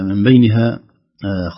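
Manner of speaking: usual speaking voice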